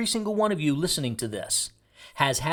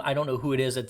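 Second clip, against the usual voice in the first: normal talking voice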